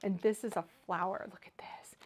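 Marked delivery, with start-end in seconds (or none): 1.3-2.0 whisper. Real, not acted. Showing off a flower die.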